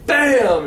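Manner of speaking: mock gunshot. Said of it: "he just goes".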